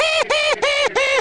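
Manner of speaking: very high-pitched cartoon bear chirps; high bear echo. Another bear echoing green bear's call, very high-pitched. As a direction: repeat it in a squeaky little bear voice.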